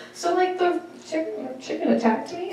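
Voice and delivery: mock-sheepish kid, hushed and high; imitated timid teen. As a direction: hesitantly confess it, rising into a question. Teen timidly reporting chicken attack.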